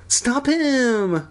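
high, whiny alarmed cry; squeaky character shout. A narrator's reading. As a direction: cry out in hammed-up panic. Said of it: "Kermit cried".

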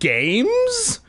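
very high, rising squeak; incredulous. A narrator's reading. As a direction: echo it back in disbelief. Boy reacts in disbelief.

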